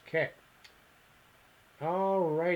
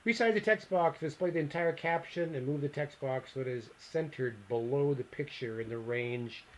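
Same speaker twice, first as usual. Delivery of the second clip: ordinary delivery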